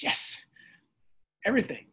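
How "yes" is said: whispered yes. Real, not acted. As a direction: breathe out the word yes. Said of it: "whispered aside".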